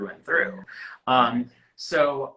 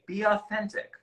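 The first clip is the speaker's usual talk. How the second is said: high, singsong imitation of stock advice; lightly mocking, pitched up; mock predicted answer; 9 semitones higher than usual. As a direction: parrot the tired advice in a higher voice. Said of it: "Let me guess what he's gonna say".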